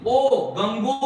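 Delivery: ओ, गंगो with the ओ loud and apart; sound demo. Shown as a demonstration. Teacher pointing out the ओ sound, emphatic. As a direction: pull the ओ out of गंगोर्मी. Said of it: "ओ, इसमें आ गया".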